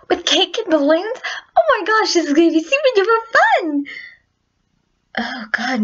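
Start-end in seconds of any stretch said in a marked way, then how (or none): 0.1-3.9 very high, giddy squeal; bubbling over with party excitement; squeaky, rushed, over-the-top cartoon pony; Pinkie Pie. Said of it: "my Pinkie Pie is really terrible".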